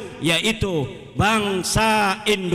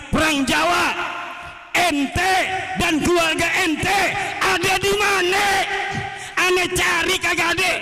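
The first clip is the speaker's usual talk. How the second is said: high-pitched, shouted taunt; indignant shouting, pitched well up; heated challenge; 10 semitones higher than usual. Real, not acted. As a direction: fire it out as a shouted confrontation.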